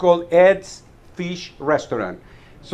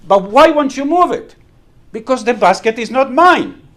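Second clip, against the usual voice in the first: heated exchange; 7 semitones higher, 6 dB louder than usual. Acted. Punchline of basket quarrel.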